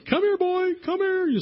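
high, loud calling out to a dog; a whole crowd hollering for the dog; crowd yelling. Retold or imitated. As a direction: shout it from the stands to call the dog.

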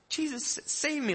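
very high, thin-volume cry for help; mock panicked plea. Sinking Peter begging Jesus, mock panicked plea.

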